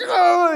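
high, loud startled cry; imitated panicked yell. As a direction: mimic someone jolted awake in a panic. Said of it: "He starts panicking".

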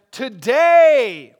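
Today proclaimed loudly with a falling tune. Retold or imitated. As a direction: ring out Today as a declaration. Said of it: "he began to say to them".

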